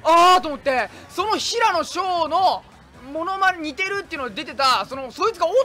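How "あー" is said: recognition. Retold or imitated. Recognizing the lookalike.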